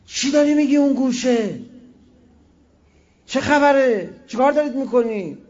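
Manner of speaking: loud, high rebuking shout across the room; sudden shout. Retold or imitated. Old cleric shouting at gossiping guests. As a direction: bellow at the whisperers in the corner. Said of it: "یه دفعه داد زد".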